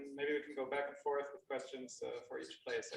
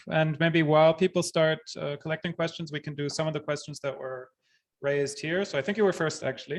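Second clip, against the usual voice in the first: louder, projecting into the room mic; speaking up; 10 dB louder than usual. Real, not acted. Host addressing the room audience, loud and projected. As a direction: project so the whole room hears. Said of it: "But now they can hear you".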